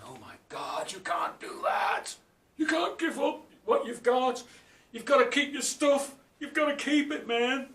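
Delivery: loud, high tempting bogeyman; high, shouted bogeyman urging him to keep his stuff. Acted. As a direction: shout it like a grasping demon. Bogeyman voiced tempting young man.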